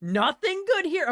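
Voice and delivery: high, loud disbelief at an empty pack; surprised question. Real, not acted. Streamer scanning a weak draft pack, in surprised disbelief. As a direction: sound genuinely let down by the cards.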